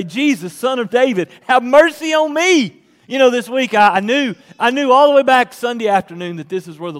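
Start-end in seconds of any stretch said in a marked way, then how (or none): 0.0-2.8 high, loud plea; crying out. Acted. Blind beggar cries out to Jesus for mercy, crying out. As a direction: call it out, rising to a cry. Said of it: "he began to cry out and say".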